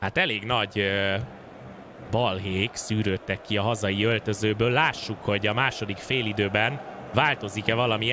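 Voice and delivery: calm low commentator voice; sports commentary. Acted. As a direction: set the scene like a TV commentator. Sets up second half as commentator.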